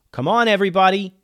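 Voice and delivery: loud, high, fast rallying call; yelling. A narrator's reading. Alex calls friends onto the boat, yelling. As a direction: holler to hurry everyone along. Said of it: "yelled Alex".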